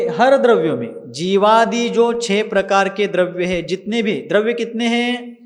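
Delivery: usual speaking voice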